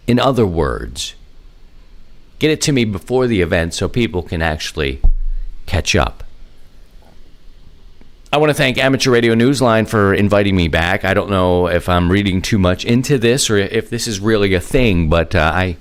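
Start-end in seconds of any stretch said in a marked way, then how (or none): none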